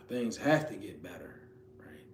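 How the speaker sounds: hushed, breathless whisper. Shown as a demonstration. Preacher to listeners at their lowest, whispered. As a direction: whisper it like a private vow. Affirming hope at lowest point.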